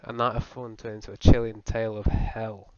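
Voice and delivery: ordinary talk, nothing marked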